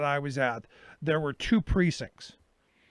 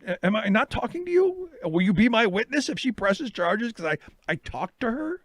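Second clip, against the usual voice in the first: mock-incredulous appeal; 6 semitones higher than usual. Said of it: "I go".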